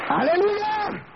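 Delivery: high, drawn-out praise shout; exultant cry. Real, not acted. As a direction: cry it out over the applauding congregation. Rousing the applauding congregation. (grito agudo de júbilo)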